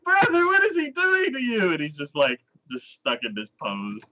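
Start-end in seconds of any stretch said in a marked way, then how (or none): 0.0-1.7 very high mock wail; hammed-up sisterly alarm; horrified sister. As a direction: cry out in scandalized dismay. Shocked sister, acted.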